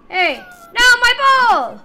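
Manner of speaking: high, loud whiny protest. Real, not acted. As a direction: yell as the ball is taken. Ball taken away in game.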